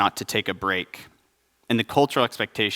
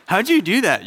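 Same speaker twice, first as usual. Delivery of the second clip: much higher, louder astonished question; amazed disbelief; 10 semitones higher, 5 dB louder than usual. Retold or imitated. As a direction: gasp at the impossible schedule. Amazed at fourteen soccer games.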